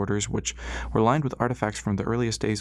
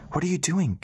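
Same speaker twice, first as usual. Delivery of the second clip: breathless; 8 semitones higher than usual. A narrator's reading. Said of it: "Callie asked breathlessly".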